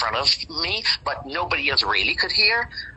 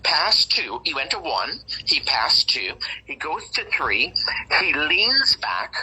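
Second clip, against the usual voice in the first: ordinary delivery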